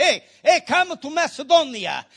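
loud high hailing call. Retold or imitated. Macedonian man in the dream calling Paul, loud. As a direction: call across like hailing someone far off. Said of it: "Maybe he said".

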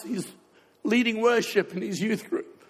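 ordinary talk, nothing marked

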